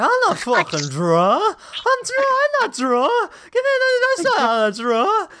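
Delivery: very high, drawn-out slurred protest; drunken denial. Retold or imitated. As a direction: imitate an indignant drunk, slow and squealing. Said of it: "reference that they're drunk".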